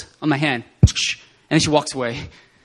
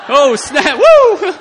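high, loud whoop of excitement; thrilled rising shout; excited whoop; 10 semitones higher, 12 dB louder, much slower than usual. Retold or imitated. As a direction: let it burst out into a whoop. Excitement as friend weeps.

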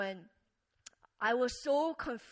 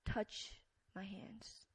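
hushed and gentle, like calming a frightened friend; gentle whisper; 3 semitones lower, 12 dB quieter than usual. Acted. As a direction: whisper it tenderly, barely voiced. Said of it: "ever so gently and he says".

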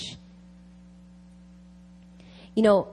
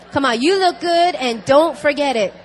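louder, higher, punchy rallying call; rousing encouragement; 5 semitones higher, 8 dB louder than usual. Real, not acted. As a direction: cheer the congregation on loudly. Urges congregation to repeat it heartily.

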